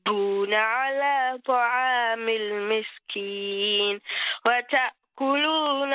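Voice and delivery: melodic tajweed chant; Quran recitation. Acted. Caller recites surah al-Fajr.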